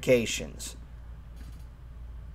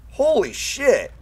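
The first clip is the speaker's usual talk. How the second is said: slow, high stunned exclamation; surprised; 11 semitones higher, 7 dB louder, slower than usual. Real, not acted. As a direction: blurt it out in shock. Sees the long distance.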